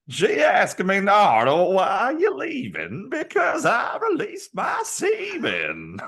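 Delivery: high, loud, strained cartoonish voice; Jacksonville impression. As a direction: squeal it out, cracking at the end. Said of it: "that's how everybody in Jacksonville talks".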